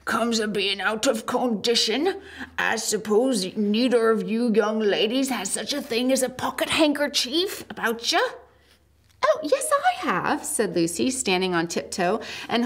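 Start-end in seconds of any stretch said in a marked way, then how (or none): 0.0-8.2 humble giant voice. Acted. Giant character voice. 9.2-10.4 put-on much higher little girl voice; Lucy's voice. Lucy voiced, very high.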